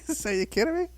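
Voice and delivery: put-on high, incredulous little-kid squeak; mock surprised child. Child self asking mother, high mock disbelief. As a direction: play your younger self hearing the nonsense. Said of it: "And I go".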